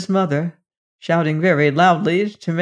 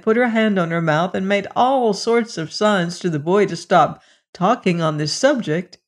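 usual speaking voice

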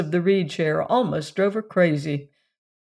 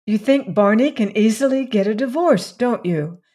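higher, eager and hopeful; eager question; 4 semitones higher, 4 dB louder than usual. A narrator's reading. Valancy asking her uncle about a divorce, eager. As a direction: lean in, hoping he says yes. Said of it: "said Valancy eagerly".

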